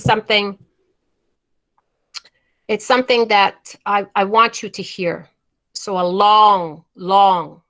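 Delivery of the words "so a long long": long stretched slow and leaned on; stress on long. Shown as a demonstration. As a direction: draw out each long. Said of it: "I'm emphasizing those words".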